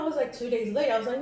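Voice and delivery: ordinary talk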